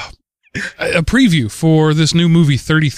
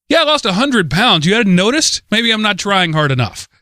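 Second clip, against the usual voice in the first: higher, mock-indignant sarcastic comeback; sarcastic retort; 6 semitones higher than usual. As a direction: snap back with exaggerated sarcasm, pitched up. Sarcastic retort to weight remark.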